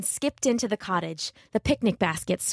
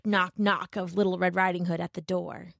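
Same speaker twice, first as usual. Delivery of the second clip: ordinary delivery, nothing marked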